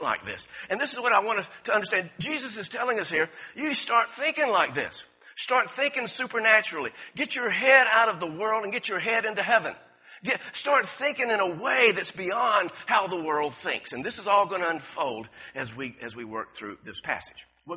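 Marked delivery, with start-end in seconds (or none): 3.6-13.9 raised, emphatic exhortation; fired-up sermon plea; preaching. Real, not acted. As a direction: press the point home to the congregation. Preacher exhorting congregation at full force.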